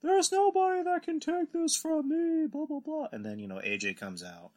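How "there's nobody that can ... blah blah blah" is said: high, slow hammed-up wrestler promo; mock heel promo, pitched way up; mock wrestler boast. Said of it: "the whole thing that every other wrestler does".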